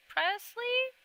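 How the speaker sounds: usual speaking voice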